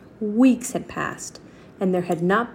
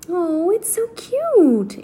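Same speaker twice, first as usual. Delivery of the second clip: cooing; 10 semitones higher, 3 dB louder, much slower than usual. Acted. Girl cooing at mouse.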